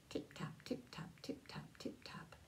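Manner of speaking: soft whispered footsteps fading; tiny footsteps whispered away; whispered tip-tap. A narrator's reading. Footsteps heard approaching.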